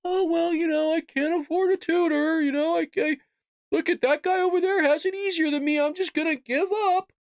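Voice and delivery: high, whining mockery of a complainer; mock whiny complainer. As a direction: sneer it like a spoiled kid who gave up. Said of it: "generation of like".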